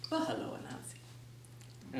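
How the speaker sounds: put-on slow, breathy old antelope greeting after clearing throat. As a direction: clear your throat, then greet him slowly and hushed like an old creature.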